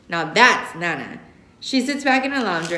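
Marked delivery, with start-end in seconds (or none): none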